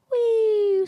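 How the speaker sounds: high loud whee of a fall. Retold or imitated. Scotsman jumping off the building, high falling whee. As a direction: squeal as he drops.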